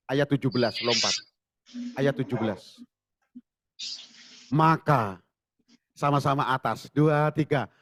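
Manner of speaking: ordinary talk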